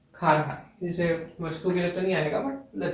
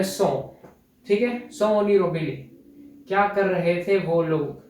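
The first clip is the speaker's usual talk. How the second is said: ordinary delivery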